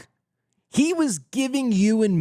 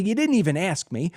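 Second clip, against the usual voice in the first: ordinary talk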